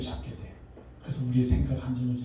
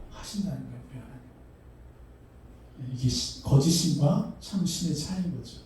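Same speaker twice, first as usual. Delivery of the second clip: normal talking voice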